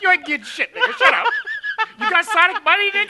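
loud, laughing taunt; loud mocking. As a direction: yell it at him while laughing. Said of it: "That's what he sounds like".

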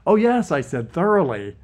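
high, eager overselling. Acted. Eager lie to the general.

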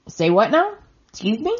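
high, incredulous questions with a sharp rise; mock disbelief. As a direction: react as if you can't believe the nerve. Mock disbelief at the retold demand.

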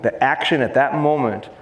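ordinary talk

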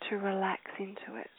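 breathy meditative whisper. Acted. Guide whispering to meditating listeners, hushed. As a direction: let the words dissolve into a whisper.

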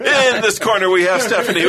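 high, loud announcer call through laughter; booming stage introduction. Pretend. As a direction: announce her like a prizefighter. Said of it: "Look at that".

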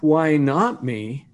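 very slow, rising question; slow and deliberate. Retold or imitated. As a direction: draw it out thoughtfully. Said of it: "as you ask".

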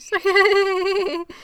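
high-pitched giddy giggling; imitated giddy titter; giddy giggle. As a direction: giggle like someone who can't stop smiling. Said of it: "She's giddy".

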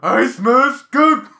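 high, loud, slow alarm; alarmed skunk alert. Retold or imitated. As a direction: announce the skunk in a panic. Wife wakes smelling skunk.

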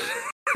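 short laugh mid-story; real laugh. Real, not acted. Bartender amused telling chat the story, laughing. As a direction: break into a laugh at your own retelling.

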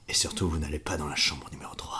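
hushed ominous whisper. Acted. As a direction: whisper the warning darkly. Sinister warning about room three.

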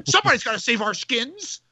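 mock actress checking in with her director; Carrie Fisher. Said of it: "And she's like".

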